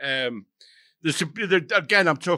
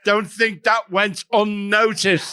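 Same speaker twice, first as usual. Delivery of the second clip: slow, mock-threatening warning; mock menace; 7 semitones higher, 5 dB louder, slower than usual. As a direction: let it hang with mock menace. Mock grudge at rival.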